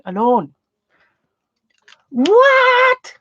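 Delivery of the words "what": sudden high, loud "What?"; surprised. Real, not acted. Aunt spotting her niece joining the stream, high surprised cry. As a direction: caught off guard, squeal it high and louder.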